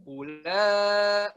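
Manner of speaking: "Ula" sung out on an even note; clean steady tone. Shown as a demonstration. Teacher showing a clean sustained "Ula", steady tone. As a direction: hold "Ula" level, no vibration. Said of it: "tapi kita bersih suaranya".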